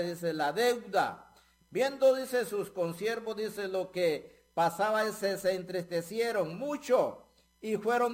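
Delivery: loud, driving sermon delivery with rhythmic "dice"; fiery preaching. Acted. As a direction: preach it out to the congregation with full force. Preaching the parable at full force.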